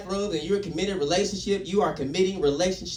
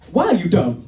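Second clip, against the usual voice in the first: loud mock Nigerian scolding; Nigerian accent; 3 semitones lower, 6 dB louder than usual. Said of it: "like, Nigerian or some shit".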